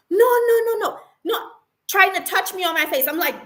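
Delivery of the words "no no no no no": much higher, loud frantic "no, no"; mock panicking manager. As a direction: imitate her rushing in to calm things down. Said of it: "comes rushing out".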